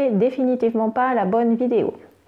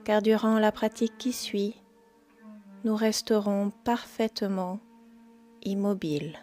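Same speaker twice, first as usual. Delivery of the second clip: guided meditation; 9 dB quieter than usual. Acted. Yoga teacher guiding listeners into yoga nidra, calm meditation voice.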